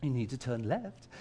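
gentle Siri. Retold or imitated. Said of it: "kindly and gently nudging me".

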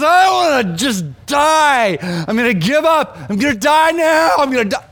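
loud, high wail of despair; re-enacted desperate wailing, pitched high; imitated screaming. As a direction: cry out like someone delirious with heat, acting it. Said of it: "He starts screaming".

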